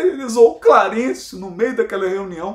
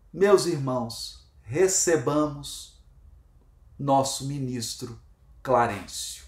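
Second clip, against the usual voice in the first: solemn announcement; 6 semitones lower, 3 dB quieter, slower than usual. Retold or imitated. Dr. Bezerra announcing the minister.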